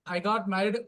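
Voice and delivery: normal talking voice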